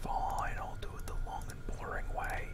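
resigned whisper under breath; whispered grumble. Real, not acted. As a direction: mutter it to yourself, defeated. Grumbling after a failed build.

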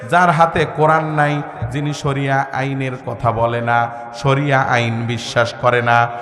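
low, intoned pronouncement; solemn preaching. Acted.

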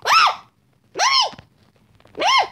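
high squealing scream for mommy; panicked cry for mommy. Real, not acted. Scared gamer screaming for mommy at a monster. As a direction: shriek in a mock panic. Kid panics as mobs attack.

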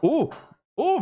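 high delighted gasp of surprise; sudden surprised oh. Real, not acted. Unboxing, surprised by item.

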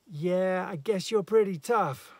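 loud, high mocking jeer; sardonic. A narrator's reading. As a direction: taunt him with scornful mock admiration. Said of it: "said the voice, sardonic".

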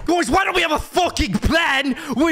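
shouted, high-pitched mock British accent. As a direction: rant at the squad in a put-on British voice. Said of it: "Why is there a British kinda, like, uh, accent in there?".

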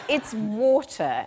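slow, careful British "water", WAW-tuh; British accent. Retold or imitated. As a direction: correct the child in English RP. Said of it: "And I went".

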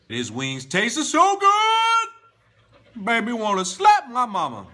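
shouted sing-song praise, high and slow; over-the-top food rave; wings so good. Acted. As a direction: belt it out like the food is amazing. Exaggerated food praise, very loud.

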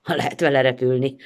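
plain reading voice

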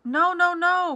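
high, loud pleading shout; calling out. A narrator's reading. The Greggs begging the armed ducks, calling out loudly. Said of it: "called out Mister and Missus Gregg".

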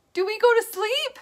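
plain reading voice

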